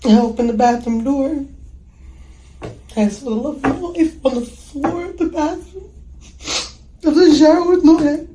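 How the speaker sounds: high, loud, distressed recounting; distraught. Real, not acted. Mother recalling finding her love dead, real anguish. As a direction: voice climbing and swelling with real anguish.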